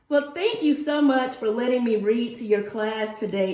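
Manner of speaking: ordinary delivery, nothing marked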